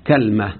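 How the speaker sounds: "كلمة" as kal-ma, a on kaf, vowelless lam; kalma, third dialect form. Shown as a demonstration. Teacher showing the third form, "كلمة" pronounced kal-ma. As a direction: say "كلمة" in two syllables, KAL-ma. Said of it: "بفتح الكاف وسكون اللام".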